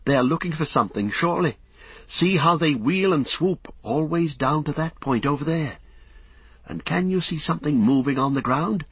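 high-pitched, urgent alarm; excited lookout's cry. A narrator's reading. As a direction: point out the danger in an agitated high voice. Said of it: "he cried".

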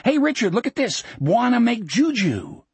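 high, giddy calling out; giddy excited. A narrator's reading. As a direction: crow it with wild joy. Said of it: "laughing like a crazy loon".

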